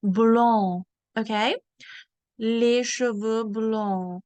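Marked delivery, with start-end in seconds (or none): 0.0-0.8 "Blond" in French, ending on the nasal vowel; French model "Blond", final consonant dropped; silent d. Shown as a demonstration. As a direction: say "Blond" firmly with no d sounded. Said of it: "the D and the S are silent".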